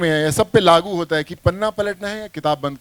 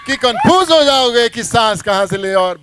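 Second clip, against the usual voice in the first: louder, punchy cop threat; loud filmi dialogue; 5 semitones higher, 7 dB louder than usual. Shown as a demonstration. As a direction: belt it out like a swaggering film cop. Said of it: "पहले dialogue बोलो". (ज़ोरदार फ़िल्मी dialogue, दबंग अंदाज़ में)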